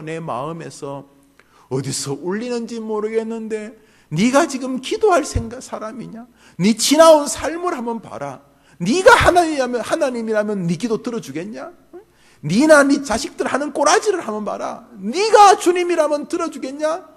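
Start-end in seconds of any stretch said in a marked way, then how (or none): 4.1-6.3 higher and louder, hammering the question; loud accusing voice. Retold or imitated. Preacher voicing the accuser at full force, loud. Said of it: "굉장히 뚜렷하게 들려옵니다". 6.6-17.1 higher, louder, mocking challenge; loud accuser. Inner accuser taunting the believer, loud and high. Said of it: "굉장히 뚜렷하게 들려옵니다".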